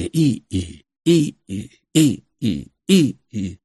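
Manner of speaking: high, thin imitated squeaks; tedious windmill creak. A narrator's reading. Windmills grating on Artyom's nerves, tedious creaking. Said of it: "скрипели нудно".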